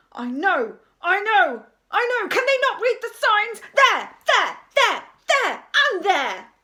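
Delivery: loud, high, frantic outburst; maniacal exasperation. A narrator's reading. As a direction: fire off each word at full volume. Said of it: "The teacher began maniacally pointing".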